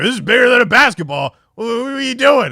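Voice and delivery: loud, fast scolding; dad yelling. Retold or imitated. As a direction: bark it like a furious dad. Dad yelling at kids over dent.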